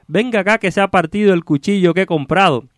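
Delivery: high-pitched, declaimed cursing in verse; theatrical exasperated ox-driver; irritated carter. Acted. Stuck carter cursing whoever called him, exasperated.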